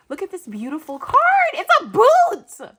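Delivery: high, loud, slowing gush; delighted squeal. Real, not acted. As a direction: climb to a drawn-out squeal. Delighted by the card.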